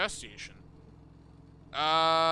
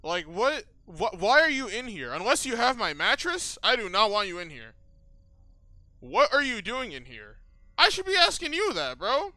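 loud, higher indignant demanding; rattled outburst climbing higher; alarmed confrontation; 5 semitones higher, 7 dB louder than usual. Real, not acted. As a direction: challenge an intruder with raised voice. Confronts stranger found in room.

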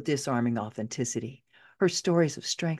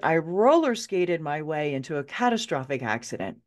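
ordinary delivery, nothing marked

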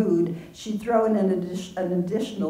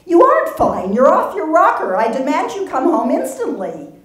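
high, loud furious order; angry shouted demand; 10 semitones higher, 9 dB louder than usual. Acted. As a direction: yell at her to come home now. Sister demands Marcy come home.

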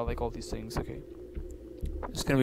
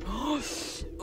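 high, sharp gasp; quick high intake of breath in fright; startled gasp; 11 semitones higher, 6 dB quieter than usual. Real, not acted. Startled by the door.

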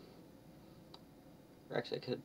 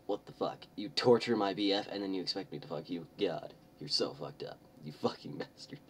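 ordinary delivery

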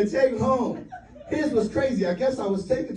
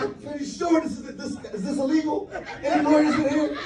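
nervous high voice; 6 semitones higher than usual. Retold or imitated. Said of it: "I'm like".

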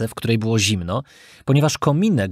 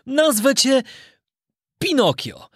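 high, gentle naming of his puppet; name the boy with pride; fond old carpenter; 12 semitones higher than usual. Acted. Carpenter names his new puppet son, fond and high. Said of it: "zawołał majster".

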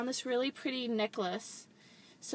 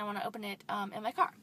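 usual speaking voice

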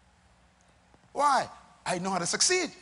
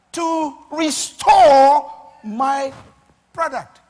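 God's solemn vow; 5 semitones higher, 8 dB louder, slower than usual. Acted. Preacher voicing the manufacturer vowing to restore his product, slowly building. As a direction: start hushed, then swell deliberately into a thundering vow.

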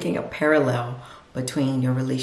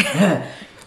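short burst of real laughter; a loud amused laugh; surprised laugh; 3 semitones higher, 6 dB louder than usual. Real, not acted. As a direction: laugh at her own words. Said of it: "I don't know where that came from".

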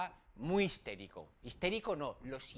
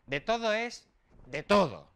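ordinary delivery

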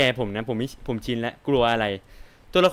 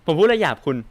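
indignant protest; 5 semitones higher, 6 dB louder, faster than usual. Real, not acted. Streamer protests a rude accusation.

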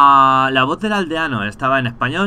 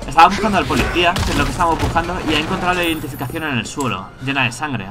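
excited rush; 4 semitones higher, 4 dB louder, faster than usual. Real, not acted. Shocked by the cutscene.

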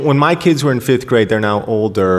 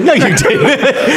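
mock disbelief; 12 semitones higher, much slower than usual. Retelling reply to wife.

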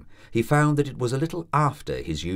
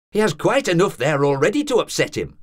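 indignant, raised landlady's reproach; angry landlady; 10 semitones higher, 6 dB louder than usual. A narrator's reading. Landlady scolding the doctor for frightening the student, indignant. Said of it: "angry questions".

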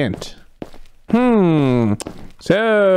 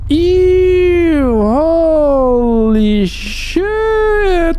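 shocked disgust; 7 semitones higher, much slower than usual. Real, not acted. Player recoiling from what the game shows, shocked disgust. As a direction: stretch every word in appalled disbelief.

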